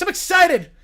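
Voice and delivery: loud, high burst of sports excitement; excited football voice. Acted. Showing off the football voice, loud and excited. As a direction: announce it like a pumped-up football guy. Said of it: "This is just my football voice".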